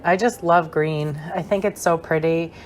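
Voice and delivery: ordinary delivery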